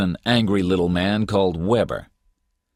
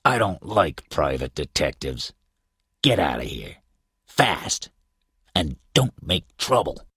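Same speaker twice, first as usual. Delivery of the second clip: through gritted teeth. A narrator's reading. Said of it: "He spoke through his teeth at me".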